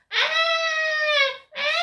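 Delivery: high, loud mock wailing of a kid; hammed-up toddler cries; imitated child crying. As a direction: wail like a small child. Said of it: "the kid's going".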